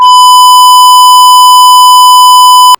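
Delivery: shrieking laugh, very high and loud; screaming laugh. Real, not acted. After her taunting song, a long shrieking laugh. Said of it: "That was a good song".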